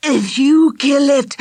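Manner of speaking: gasping high, fighting for air; choking. Acted. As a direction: force it out between chokes. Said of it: "Charlie was choking and fighting for breath".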